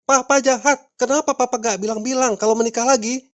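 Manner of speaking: high-pitched hurt protest; upset wife accusing. Acted. Wife confronting husband about marrying again, upset. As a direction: accuse him in a high, aggrieved voice.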